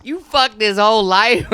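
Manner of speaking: ordinary delivery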